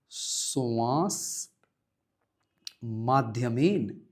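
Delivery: very slow spelled-out answer; drawn-out teacher dictation. Shown as a demonstration. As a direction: dictate it syllable by syllable. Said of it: "answer dictated".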